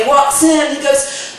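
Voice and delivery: ordinary delivery, nothing marked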